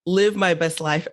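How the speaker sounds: ordinary talk, nothing marked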